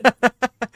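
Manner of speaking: short high loud laugh. Real, not acted. Laughing at the friend's reply. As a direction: yelp of laughter.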